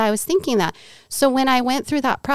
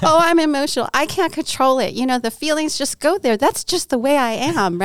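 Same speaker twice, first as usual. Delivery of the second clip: higher, whiny self-mockery; mock complaining excuse; 7 semitones higher than usual. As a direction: parody your own excuse, pitch up. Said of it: "I always complain".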